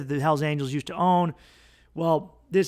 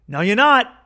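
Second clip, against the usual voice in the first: loud, high, flat refusal; defiant shout; 8 semitones higher, 9 dB louder than usual. Acted. Hells Angels refusing the butt chuggers' defection, defiant shout. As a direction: bark the no back at them, up high and loud.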